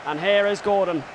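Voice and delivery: brisk football commentary call; live play-by-play. Acted. Gamer voicing commentary over his own match, play-by-play. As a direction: narrate the build-up like a TV commentator.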